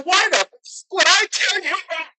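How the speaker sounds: high-pitched, incredulous comeback; retold disbelieving outburst; incredulous retort. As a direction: throw it back in mock outrage, voice way up. Retorting to the cop's question.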